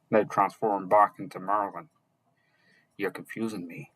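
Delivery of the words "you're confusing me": annoyed mutter. A narrator's reading. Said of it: "more annoyed this time".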